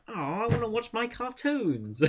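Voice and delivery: high, put-on little girl's complaint; whining child. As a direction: whine like a kid denied TV. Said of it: "and she's just like".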